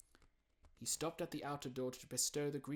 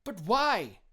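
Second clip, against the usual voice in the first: loud, higher protest; cried out; 10 semitones higher, 14 dB louder than usual. Acted. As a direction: cry out in disbelief. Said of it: "cried Scrooge's nephew".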